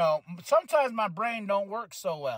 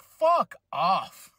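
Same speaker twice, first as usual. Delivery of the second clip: snapped, louder curse at fans on Twitter; brushing off annoying fans with a louder retort; fuck off; 6 dB louder than usual. Retold or imitated. Annoyed fan tells Twitter fans off, louder snap. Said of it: "I'm like".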